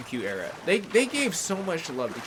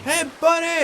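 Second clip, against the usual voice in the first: high, loud friendly hail; hammed-up greeting across the water; mock cheery hail; 14 semitones higher, 8 dB louder than usual. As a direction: call out like a cheeky pal. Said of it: "laughing off and like".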